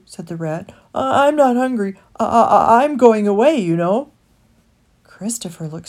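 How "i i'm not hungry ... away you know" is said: stuttering, raised and strained; tight with emotion. Acted. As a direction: get the words out past the nerves. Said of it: "The rat's voice was tight with emotion".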